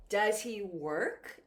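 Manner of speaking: "work" climbs like an airplane; measured model question, pitch lifting through "work"; rising question intonation. Shown as a demonstration. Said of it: "It goes up like an airplane".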